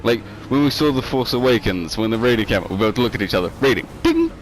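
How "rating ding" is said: high sing-song ding; mock ding. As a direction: chime it like a rating alert. Mock rating announcement sound.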